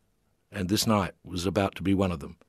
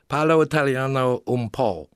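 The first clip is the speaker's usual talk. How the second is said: halting Italian; 4 semitones higher than usual. A narrator's reading. Tourists answer in halting Italian.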